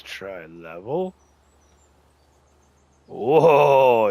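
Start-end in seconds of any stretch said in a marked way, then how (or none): 3.1-4.1 loud startled whoa; surprised whoa. Real, not acted. Player reacts to sudden terrain jump, loud whoa. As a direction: let it burst out.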